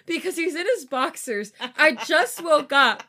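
high, loud, flustered panic; stressed rant. Real, not acted. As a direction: vent in a stressed rush. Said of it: "I'm genuinely stressed right now".

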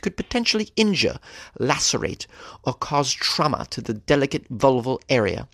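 a lightly put-on British accent, prim and proper. Host keeps quoting the English gynecologist in a British accent. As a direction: read the doctor's warning in a mild British accent. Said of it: "fun to say with a British accent".

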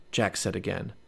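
ordinary delivery

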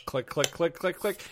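soft, evenly spaced "click" repeats; quiet, deadpan sound effect; imitated empty-gun clicks. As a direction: mimic a trigger on an empty chamber.

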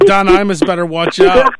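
usual speaking voice

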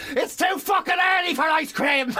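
cranky man yelling at an ice cream eater; shouted at a stranger. Retold or imitated. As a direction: yell it out the car window. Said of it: "Rolled down my window and went".

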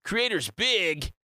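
hammy announcer, stretching the phrases slowly; Tang commercial voice. Acted. Streamer selling a fan event to listeners, cheesy commercial voice. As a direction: sell it like a cheesy drink advert. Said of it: "I was doing my Tang commercial voice".

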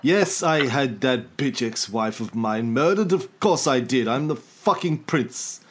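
put-on slow, haughty royal English accent; hammed-up royal English, self-important and unhurried; Prince Charles accent. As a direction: brag in a drawn-out mock Charles accent. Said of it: "doing my Prince Charles accent".

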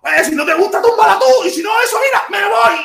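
hammed-up rough shout; mock angry shout. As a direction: bark it out like a fed-up partner. Imitating brusque partner shouting.

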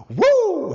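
loud high "woo"; Ric Flair whoop. Retold or imitated. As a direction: let out a drawn-out, high wrestler's whoop. Imitates Ric Flair's famous whoop.

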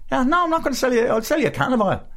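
high-pitched fake-jolly Dublin shopkeeper; mock cheeriness hiding the anger; happy Dub. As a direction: put on a squeaky, laughing Dub. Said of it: "you're not funny, you're not having a laugh".

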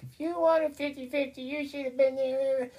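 high, mocking Christine voice with a slow, pointed finish; high mock quote of a mother scolding the absent father; Christine's retort. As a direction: toss the line back as Christine, high and cutting. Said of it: "I love how Christine's like".

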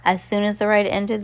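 plain reading voice

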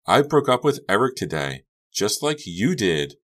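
high, breezy, pointed tone; cheerfully twist the knife; bright and cheerful. A narrator's reading. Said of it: "tone was bright and cheerful".

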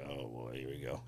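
very low, hushed aside; retold private dread. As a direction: mutter it to yourself, resigned to what's coming. Retold dread as pain returns.

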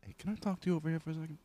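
hushed confrontation. Acted. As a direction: take someone aside as a tiny wise guy. Tommy takes teacher aside.

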